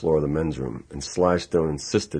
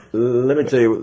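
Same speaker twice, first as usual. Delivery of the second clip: laughing; 7 semitones higher than usual. Retold or imitated. Laughing while retelling to Sly.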